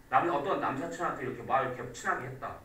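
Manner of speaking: ordinary talk, nothing marked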